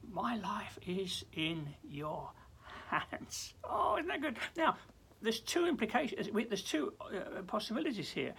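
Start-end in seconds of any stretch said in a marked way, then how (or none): 0.1-3.5 slow whisper, very soft; hushed, intimate prayer. Pretend. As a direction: breathe it like a secret. Quiet echo of the prayer.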